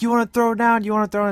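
high, imitated fighting challenge; mock challenge. Partners starting their fight up, retold mock challenge. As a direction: pitch it up, picking a mock fight.

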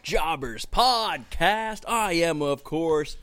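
big, high opening easing down to a teasing hush; hammed-up announcer intro. Host welcoming everyone to the podcast, showy announcer voice.

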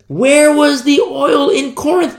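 WHERE hit high and hard; rising preacher cry. Acted. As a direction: call it out to the congregation. Preacher repeats rhetorical question.

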